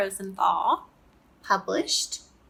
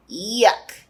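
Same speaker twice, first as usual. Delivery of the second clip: disgusted; 4 dB louder than usual. Acted. Child forces down hated candy.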